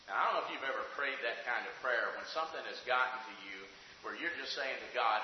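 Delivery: usual speaking voice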